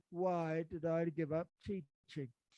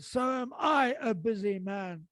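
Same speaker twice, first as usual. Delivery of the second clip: loud, slow, higher furious retort; livid retort; 5 semitones higher, 10 dB louder, slower than usual. Retold or imitated. Retelling how he snapped at the secretary, loud slow fury. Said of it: "And I was livid".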